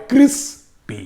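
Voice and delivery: Crispy slowly, pausing before the final pi; two separate beats on crispy, cris then pi; split into syllables. Shown as a demonstration. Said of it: "আর দুটো যদি হয়".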